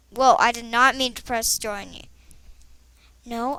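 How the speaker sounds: usual speaking voice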